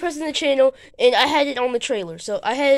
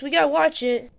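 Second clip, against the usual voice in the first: normal talking voice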